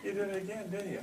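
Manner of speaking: mock accusing husband. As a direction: needle the wife with a put-on accusation. Husband's retort to upset wife.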